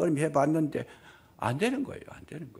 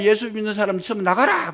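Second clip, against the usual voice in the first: shaman's shout; 9 semitones higher, 9 dB louder than usual. Retold or imitated. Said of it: "무당이 안 되니까".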